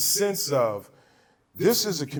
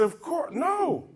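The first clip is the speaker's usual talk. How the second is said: retold protest; 14 semitones higher, slower than usual. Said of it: "I said".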